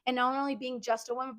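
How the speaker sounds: ordinary delivery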